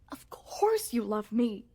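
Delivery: ordinary delivery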